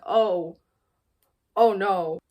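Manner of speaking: loud, panicked "oh, oh no"; flustered "oh no". Acted. A reader voicing Saoirse, a loud flustered oh no. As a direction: blurt it out loud, caught off guard. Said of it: "And Saoirse's like".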